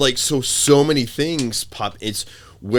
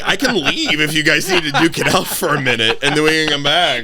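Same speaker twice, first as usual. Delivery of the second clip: higher playful mock offer; joking referee; 6 semitones higher, 3 dB louder than usual. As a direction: tease them to settle it. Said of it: "I'm like".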